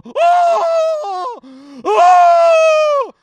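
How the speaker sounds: high ghostly wail, loud and drawn out; hammed-up ghost howl; ghostly moan. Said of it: "He used to go".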